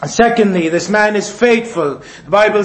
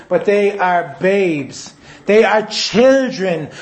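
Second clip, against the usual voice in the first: slow emphasis; 3 semitones lower, much slower than usual. Real, not acted. Stresses believers are mere babes.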